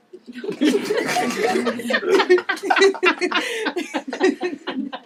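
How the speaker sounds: big loud cackling laugh; loud burst of laughter. Acted. Liv laughing at the guy on the couch, loud laugh.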